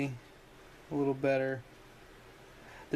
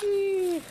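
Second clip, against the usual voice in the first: high excited squeal; delighted squeal; 6 dB louder than usual. Real, not acted. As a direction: squeal with glee. Squeal at all the bits.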